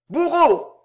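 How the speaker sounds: high, loud ringing call. A narrator's reading. Fausta calls the executioner, ringing. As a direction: ring the word out like a verdict. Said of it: "d'une voix éclatante".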